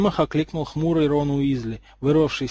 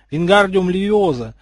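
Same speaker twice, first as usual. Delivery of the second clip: spell incantation; 5 semitones higher, 6 dB louder than usual. A narrator's reading. Casting a levitation spell.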